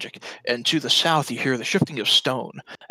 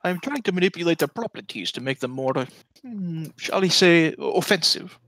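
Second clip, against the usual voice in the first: higher, lightly voiced scholarly mage; guarded mage; 6 semitones higher than usual. Mage explaining the statues, higher and careful. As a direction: choose words delicately, with deliberate hesitation.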